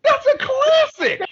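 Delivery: high, slow, loud protest; mock outrage. Real, not acted. Insisting to friends the movie is great, high outcry. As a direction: cry out in defence of the film.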